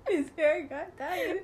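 slow words through real laughter; laughing. Real, not acted. Laughing as she corrects herself to her friend. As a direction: giggle your way through the correction. Laughing while clarifying the goat.

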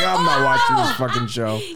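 laughing retold protest. Quoting own past reaction, laughing.

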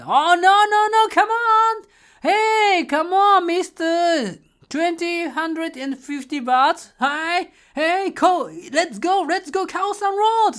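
hammed-up bargaining with a tuk-tuk driver; pushy hyped haggling. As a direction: push the driver hard like an overexcited tourist. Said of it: "超プッシュしたの".

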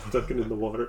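ordinary delivery

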